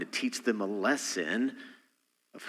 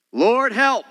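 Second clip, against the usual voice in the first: desperate cry; 12 semitones higher, 9 dB louder than usual. Acted. Sailors crying out in trouble.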